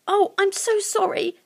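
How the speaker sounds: put-on high-pitched little girl apology; apologetic Lucy. Lucy to the hurt creakers, high and apologetic.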